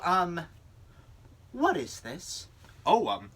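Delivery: ordinary delivery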